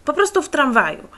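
loud, high, curt repeat; insist, fed up with the questions; impatient loud insistence; rude retort. A narrator's reading. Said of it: "odparł niezbyt grzecznie".

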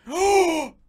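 a stunned crowd crying out; hammed-up shocked exclamation; mock audience gasp. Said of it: "the audience goes".

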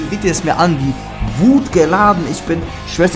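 ordinary talk, nothing marked

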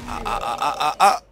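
loud mocking ha ha; gleeful laugh. Real, not acted. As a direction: cackle at the failed armor roll. Gleeful at opponent's failed armor.

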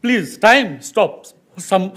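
ordinary delivery

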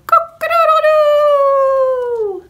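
loud, high, long-held cock-a-doodle-doo; rooster crow. A narrator's reading. Rooster waking the farmer at sunrise, crowing loudly. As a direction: crow at full volume like a rooster. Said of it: "the rooster crowed".

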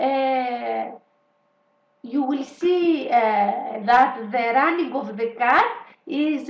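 put-on slow, halting, Greek-accented English speech. Imitating Tsipras addressing Merkel in slow Greek-accented English. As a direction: stumble through it like a clumsy statesman. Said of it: "να βγει ο Τσίπρας και να πει".

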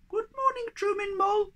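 very high squeaky little creature; tiny creature voice. Acted. Thingamy greeting Moomin Troll, high and squeaky. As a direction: squeak it like a tiny odd being.

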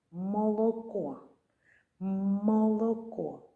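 "Молоко" drawn out slowly, sound by sound. Shown as a demonstration. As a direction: stretch "Молоко" so each sound is heard. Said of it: "Давайте знайдемо всі звуки". (повільно, по звуках)

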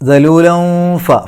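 high, loud ദലൂലം with a long hum into ഫ; concealed n. Shown as a demonstration. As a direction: hide the n at the end of ദലൂലം before ഫ. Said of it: "ഇഖ്ഫാ ചെയ്യുക". (ദലൂലം ഫ: ഇഖ്ഫാ ചെയ്ത് ഓതുക)

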